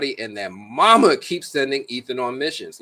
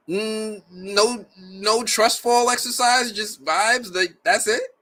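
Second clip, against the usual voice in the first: high-pitched, hammed-up incredulous protest; mock disbelief; 9 semitones higher, slower than usual. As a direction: question it in a high, baffled voice, rising at the end. Acting out a viewer's disbelief.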